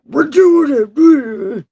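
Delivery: high, loud, slow cry; drawn-out wail. Real, not acted. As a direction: wail it out, stretching the words. Repeats it, escalating into a wail.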